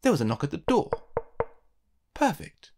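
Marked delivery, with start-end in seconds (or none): 2.1-2.7 cried. A narrator's reading. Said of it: "cried Mister Perfect".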